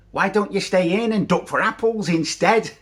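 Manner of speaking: high-pitched imitation of mum; mum's voice. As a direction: play a mother making a suggestion, pitched well up. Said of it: "she said".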